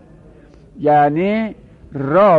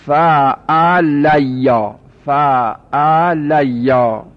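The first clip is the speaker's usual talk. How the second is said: drawn-out recitation; much slower than usual. Shown as a demonstration.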